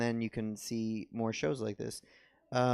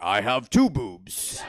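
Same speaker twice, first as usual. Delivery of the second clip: slow, loud, heavy mock voice; mock woman voice; 9 dB louder, much slower than usual. Woman announcing herself to the comic, slow and loud. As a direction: boom it out slowly like a lumbering giant. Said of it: "she's over here like".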